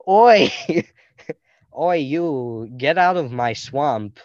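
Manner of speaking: loud mock Scottish ogre, laughing into it; hammy Shrek impression, shouted; a silly Scottish growl at the intruder; bad Shrek accent. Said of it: "enough of my stupid accents".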